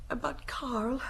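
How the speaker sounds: unvoiced whisper of the name; hushed confession. Sister whispering to the inspector about her brother Karl. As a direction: let it slip out as a secret.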